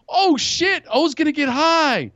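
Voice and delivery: high-pitched thrilled onlookers; excited friends. Retold or imitated. Friends watching O take his first hit, excited. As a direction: imitate buddies buzzing with anticipation.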